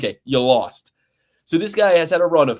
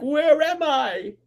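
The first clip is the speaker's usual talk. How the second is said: dazed fighter; 13 semitones higher, much slower than usual. Retold or imitated. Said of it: "He's like".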